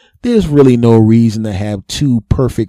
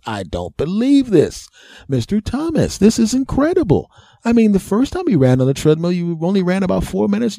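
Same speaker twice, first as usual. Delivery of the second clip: much higher, astonished doctor voice; amazed doctor; 10 semitones higher than usual. Retold or imitated. Doctor amazed at patient's stamina, high astonished voice. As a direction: gush with disbelief as the doctor.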